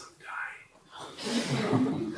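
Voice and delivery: whispered, weak sickbed reply; very soft. Acted. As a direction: whisper it like a failing patient. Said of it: "very softly says".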